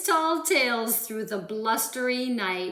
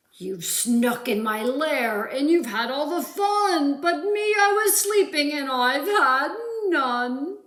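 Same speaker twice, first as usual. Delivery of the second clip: louder, higher, crying bear complaint; whimpering bear; 4 semitones higher, 6 dB louder than usual. Acted. Bear whining to the animals, crying. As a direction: wail it like a hurt, tearful bear. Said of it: "he whimpers, and he moans".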